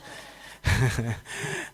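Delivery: unvoiced burst of laughter; real chuckle. Real, not acted. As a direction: laugh after telling the tale. Laughs after telling the curse.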